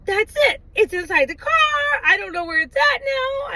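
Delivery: re-enacted panic, pitch climbing; worked-up distress. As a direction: act fed up and frantic. Re-enacting her panic over lost bug.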